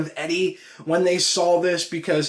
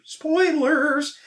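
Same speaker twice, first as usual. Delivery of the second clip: a sudden high spoiler yelp; mock alarm; 11 semitones higher than usual. Real, not acted. Reviewer blurts a spoiler warning to viewers, high and sudden.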